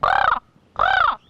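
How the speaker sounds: two loud, high crow caws; cawing back. Retold or imitated. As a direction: answer a crow in its own caws. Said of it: "I said back to him".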